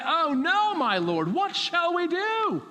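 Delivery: preacher mimics our panic, high and alarmed; mock panic. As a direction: ham up the worried servant's cry. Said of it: "we look at a situation and we say".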